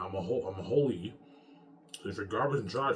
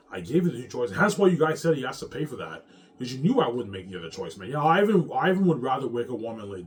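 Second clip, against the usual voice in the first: loud shouting; 4 semitones higher, 8 dB louder than usual. Real, not acted. Shouting at his enemies.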